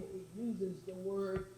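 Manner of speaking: ordinary talk, nothing marked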